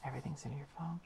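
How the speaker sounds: whispered aside. Real, not acted. Musing to viewers about phones, whispered aside. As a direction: drop to a whisper.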